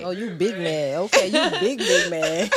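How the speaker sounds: giggling through the taunt; real amused laughter while mocking; laughing. Real, not acted. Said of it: "Oh, that's a good one".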